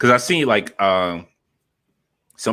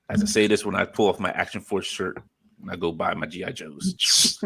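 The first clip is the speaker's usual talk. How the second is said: ordinary talk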